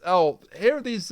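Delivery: ordinary talk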